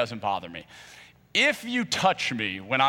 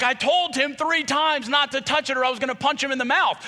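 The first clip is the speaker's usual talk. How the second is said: indignant yell; 11 semitones higher than usual. Retold or imitated. Shouting back at the family over punching Wesley. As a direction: yell it like it was obvious.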